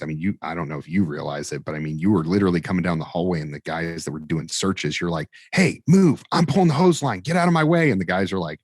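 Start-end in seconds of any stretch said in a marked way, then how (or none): 5.5-7.9 much higher, louder barking of orders; aggressive shout. Retold or imitated. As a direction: shove the crew aside while hauling the hose. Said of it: "you got very aggressive".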